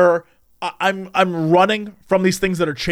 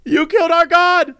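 high, bawling accusation, hammed up; the dream wife bawling at her husband, mock wail; 10 semitones higher than usual. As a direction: imitate a weeping woman blaming him. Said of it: "she's like".

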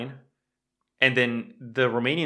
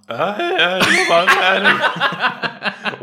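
loud laugh; 9 semitones higher, 8 dB louder, much slower than usual. Real, not acted. Brushing off a compliment on his voice, loud laughing. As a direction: burst out laughing, much higher and louder. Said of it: "the audio capture spiked".

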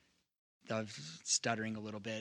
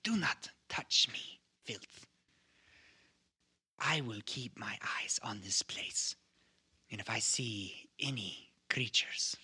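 whispering hunter. Acted. Hunter rebuffs Beleren.

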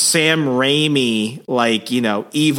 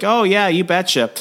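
higher, folksy Minnesota "you betcha"; sing-song Minnesota local, pitched up; Minnesota accent; 8 semitones higher than usual. Retold or imitated. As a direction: bartender confirming to the heroes, in a higher Minnesota accent. Bartender quoted, Minnesota phrase.

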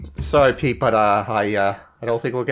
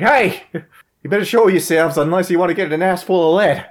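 loud high shout; shouting threat; 7 semitones higher, 6 dB louder than usual. Acted. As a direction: yell a threat at the dark. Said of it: "and I'm just like".

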